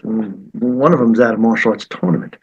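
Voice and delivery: usual speaking voice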